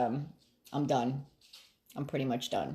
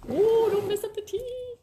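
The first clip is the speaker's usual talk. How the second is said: much higher, sudden alarmed cry; startled warning; 4 dB louder than usual. Real, not acted. Warning the pet away from her tea, a startled high yelp. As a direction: yelp as the pet nears the drink.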